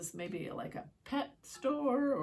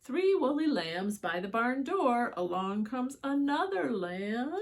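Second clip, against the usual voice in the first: storybook reading; 3 semitones higher, 7 dB louder than usual. A narrator's reading. Reading picture book aloud.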